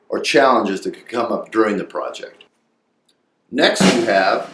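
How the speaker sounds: usual speaking voice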